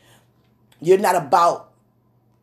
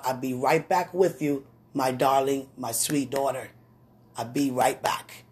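tender reassurance; 4 semitones lower, 6 dB quieter than usual. Acted. Mother soothing daughter.

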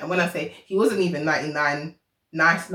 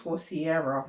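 ordinary talk, nothing marked

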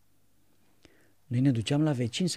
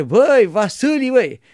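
put-on loud high call to the boy; old monk calling; 9 semitones higher, 12 dB louder than usual. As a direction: call him over, hearty. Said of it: "Și zice".

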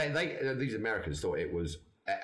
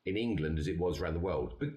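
normal talking voice

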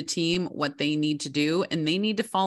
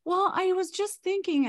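much higher, playful kid voice imitating her child; imitating a late-night kid; 14 semitones higher than usual. A parent mimics her child stalling bedtime with a question, in a high kid voice. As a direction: mimic a wheedling kid who wants to talk. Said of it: "They're like".

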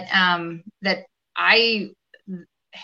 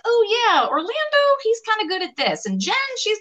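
high-pitched imitation of office chat; breezy, pitched-up vague praise; mock casual appraisal. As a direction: mimic someone vaguely vouching for colleagues. Mimics casual anecdotal talk about colleagues.

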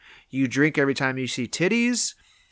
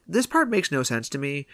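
ordinary talk, nothing marked

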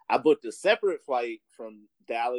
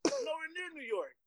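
incredulous high protest. Retold or imitated. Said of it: "I was like".

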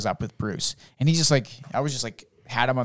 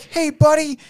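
high, bright-pitched hey buddy; cheery greeting; 4 dB louder, slower than usual. Retold or imitated. Dad greeting his son at pickup, pitched high. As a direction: greet the kid with big cheer.

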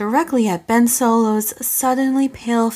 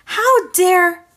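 indignant; 9 semitones higher, 5 dB louder than usual. Acted. Indignant outburst.

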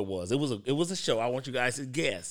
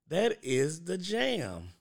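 slow and savoring; 5 semitones higher, much slower than usual. Real, not acted. Savoring the song.

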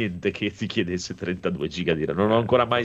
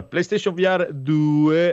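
announcer voice; 7 semitones higher, 5 dB louder, much slower than usual. Acted. Host announcing the news item.

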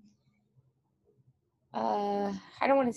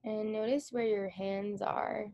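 guided meditation. Acted. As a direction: ease them into stillness with long pauses. Guided meditation begins, eyes closed.

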